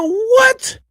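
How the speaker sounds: very high cracking yelp; excited what. Real, not acted. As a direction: squeal it out in disbelief. Excited reaction to own riff.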